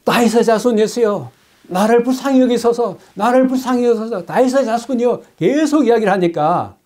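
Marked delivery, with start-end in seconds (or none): 0.0-5.2 high, insistent cry repeated over and over; repeated pleading cry. Retold or imitated. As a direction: keep crying out, higher each time. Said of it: "한 번 지르고 끝난 것이 아니라". (계속 높은 소리로 되풀이해 외치는 애원)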